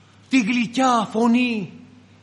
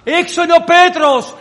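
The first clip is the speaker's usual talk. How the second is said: higher, louder, near-shouting repeat; louder insistence; 7 semitones higher, 8 dB louder than usual. Acted. Servant girl repeating to the disbelieving brothers, louder. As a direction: insist harder this time.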